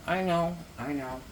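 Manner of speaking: chewing a carrot while talking; mouth full. Real, not acted. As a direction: talk through a mouthful of carrot. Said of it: "if you hear me crunching".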